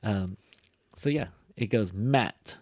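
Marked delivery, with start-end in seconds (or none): 1.9-2.6 "mat" drawn out and stressed; stressed syllable. Shown as a demonstration. Teacher showing students the stressed syllable, stressed. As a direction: lean long and hard on "mat". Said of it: "That's, like, the stress".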